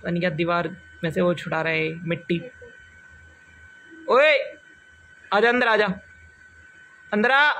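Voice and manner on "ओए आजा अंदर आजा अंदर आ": calling out. Real, not acted. Vlogger calling a boy to come inside, raised voice.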